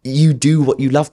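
retold moment of rousing self-belief; earnest self pep talk. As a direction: urge yourself on in the mirror. Acted pep talk to mirror.

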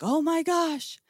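high, astonished exclamation; retold amazed yell. Friends spotting the bright light, high amazed exclaim. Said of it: "I hear them yelling".

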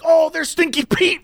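high excited call. Retold or imitated. Coworker spotting Stinky Pete, high excited call. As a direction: point him out thrilled.

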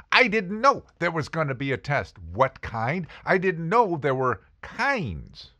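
normal talking voice

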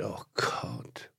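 tearful whisper, choked up; almost crying. Real, not acted. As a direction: whisper it, on the verge of tears. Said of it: "Jetzt weinst du schon fast". (den Tränen nahe geflüstert)

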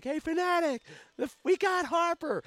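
imitating a fan hollering to the mascot; very high, loud shout; excited yell. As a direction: yell the big news for the whole section. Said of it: "he wanted the fan to yell it".

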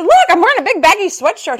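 defensive excuse. Retold or imitated. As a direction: plead your case, half laughing. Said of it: "I said".

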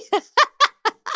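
high cackling laugh; squealing laugh. Real, not acted. After saying she loves Raymond, cackling. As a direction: break into a shrill giggle. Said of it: "I'm sorry".

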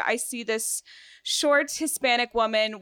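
ordinary talk, nothing marked

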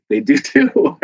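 put-on childish they-do-too comeback, teasing; playful retort. Insisting to doubters that bones do bend, teasing comeback. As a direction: fire it back like a schoolyard comeback.

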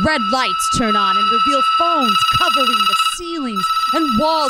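loud, high, frantic storytelling; frantic loud narration. Acted. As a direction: push it loud over the ringing. Narrating maddening ringing.

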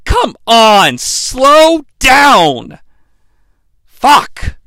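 loud, high, drawn-out plea; fed-up yell with long pauses; exasperated outburst. Real, not acted. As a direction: let each word ring out in frustration. Exasperated at the rushed scene.

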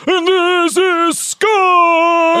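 high, slow drawn-out shout; stretched shout. Pretend. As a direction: belt it out slowly. The host echoes it again, stretched out.

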